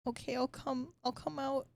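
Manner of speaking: put-on high, soft child voice; timid little boy. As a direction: agree hesitantly as a small scared boy.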